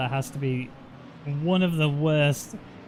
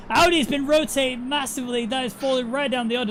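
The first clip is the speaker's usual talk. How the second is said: higher, louder race commentary; excited play-by-play; 6 semitones higher, 3 dB louder than usual. Acted. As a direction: call the action as it unfolds.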